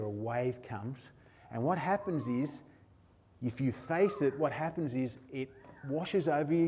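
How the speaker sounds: guided imagery. Acted. Preacher guiding listeners through a wave image, soft and low.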